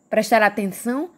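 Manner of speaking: ordinary talk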